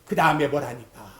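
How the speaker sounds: usual speaking voice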